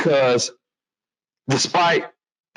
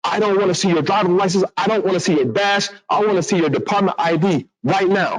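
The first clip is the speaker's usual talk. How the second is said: higher, pushier demand, a retold mock confrontation; aggressive confrontational tone; 7 semitones higher than usual. Training officer confronting the stopped driver: imitating aggressive demand. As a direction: bark the orders at him in an imitating, hammed-up way. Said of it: "with this very aggressive and confrontational tone".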